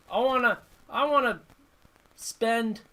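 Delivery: ordinary talk, nothing marked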